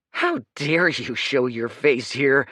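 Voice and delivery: outraged. Acted. Leo outraged.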